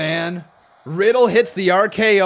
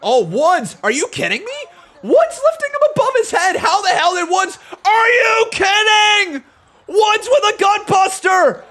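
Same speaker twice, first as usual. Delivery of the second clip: much higher and louder, shouting in disbelief; amazed shouting; 11 semitones higher, 4 dB louder than usual. Real, not acted. Commentator stunned as Woods lifts Riddle overhead, shouting in disbelief. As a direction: yell it like you can't believe the strength.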